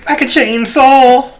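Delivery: high, loud hammed-up villain screech; mock killer shriek. Cohost hams up a killer threat to the hosts, high mock shriek. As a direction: shriek it like a cartoon slasher.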